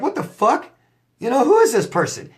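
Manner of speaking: high, incredulous what the fuck; stunned at who they've become. Retold or imitated. To the changed ex, high disbelieving shock.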